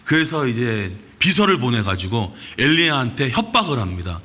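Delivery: usual speaking voice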